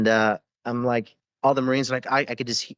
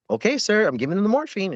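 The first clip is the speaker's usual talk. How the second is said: high sing-song sarcastic okay sir; comply with exaggerated, mocking eagerness; mock compliance; 9 semitones higher than usual. Medic giving in to the captain's order, mock-obedient high voice.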